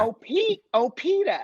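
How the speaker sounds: high-pitched New England cartoon wife; Lois. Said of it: "it's like Lois from Family Guy".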